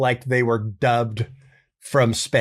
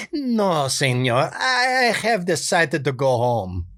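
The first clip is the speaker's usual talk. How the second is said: put-on a nice guy begging off in a thick Spanish accent; hint of dubbed Spanish accent; Benito voice; 3 semitones higher, slower than usual. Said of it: "Benito's voice".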